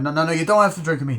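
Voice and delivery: fast, high, loud protest; retold insistent refusal. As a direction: wave off the offer in a rush. Acted refusal in bar quarrel.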